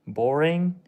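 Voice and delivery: ordinary delivery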